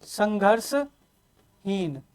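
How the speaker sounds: संघर्षहीन spoken very slowly, each syllable stretched and soft; slow, quiet teacher's model of संघर्षहीन; drawn-out answer. Shown as a demonstration. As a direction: stretch संघर्षहीन out for the class to copy. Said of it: "पांचवा क्या हो जाएगा आपके पास?".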